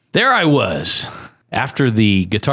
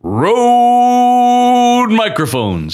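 slow, high, loud sponsor call; announcer voice; 12 semitones higher, 4 dB louder, much slower than usual. Pretend. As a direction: stretch it out like a game show host. Sponsor name called out.

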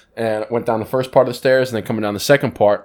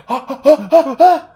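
high, rising yelps of a fall; mock panicked yelps; 12 semitones higher, 4 dB louder, slower than usual. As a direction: yelp higher and higher as if tumbling. Falling down the stairs, retold.

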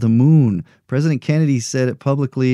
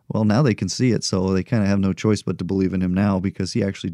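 usual speaking voice

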